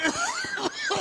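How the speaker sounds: high, shouted laugh. Acted. Amused laugh at insult.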